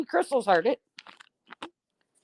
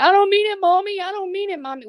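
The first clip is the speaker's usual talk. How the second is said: put-on high, loud tearful child's plea; crying little boy; 11 semitones higher, 4 dB louder, faster than usual. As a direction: imitate a small son sobbing an apology to mom. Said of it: "busted out into tears and said".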